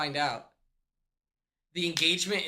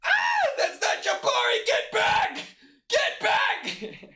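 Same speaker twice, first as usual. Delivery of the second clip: very high, loud hammed-up scream; mock panicked shriek; 3 dB louder, slower than usual. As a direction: shriek and shoo the stranger back. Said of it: "Martha were like".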